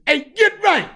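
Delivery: loud high call of a street name across the lot. Shown as a demonstration. Calling his street name out loud to Get Right. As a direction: belt the name out with your chest. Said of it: "you gotta say it a certain way".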